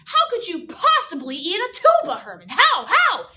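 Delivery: high, loud repeated how; incredulous. Retold or imitated. Miss Irby demanding how Herman ate a tuba. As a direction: demand in disbelief.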